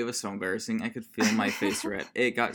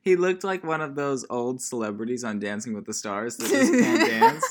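higher, laughing through the words; 6 semitones higher, 8 dB louder than usual. Real, not acted. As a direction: crack up while describing someone. Making fun of guy's dancing.

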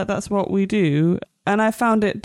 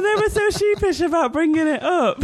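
real laughter; 9 semitones higher than usual. Real, not acted. Podcaster sharing a bunk bed joke, helpless laughing. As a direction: struggle to talk through giggles. Cracking up over the bunk beds.

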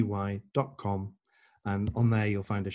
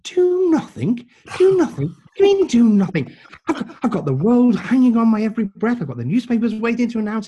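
loud, high-pitched, exasperated disbelief; incredulous outburst; 12 semitones higher, 9 dB louder than usual. Retold or imitated. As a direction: explode at the absurd suggestion. Retold outburst at friend's advice.

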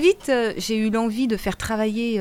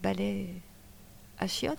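soft, hesitant shy question; 12 dB quieter than usual. Pretend. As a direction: say it timidly, barely daring.

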